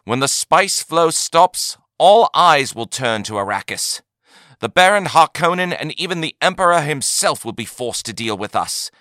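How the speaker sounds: loud, high, rousing leader; commanding crowd address. A narrator's reading. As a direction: proclaim it to the crowd.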